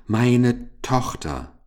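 slow, high, sobbing cry. Acted. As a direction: cry it out through sobs. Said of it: "rief Goldwina schluchzend".

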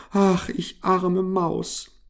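slow, high wailing little mouse; drawn-out whimpering complaint; lamenting. A narrator's reading. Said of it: "klagte sie".

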